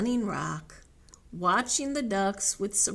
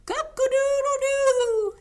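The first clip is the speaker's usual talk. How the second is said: high, loud crow with a wobbling tail; snickering crow; 8 dB louder than usual. Acted. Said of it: "he snickered".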